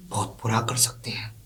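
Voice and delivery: soft whispered menace; husband warning his wife, whispered and menacing; whispered threat. Acted. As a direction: breathe the threat out quietly.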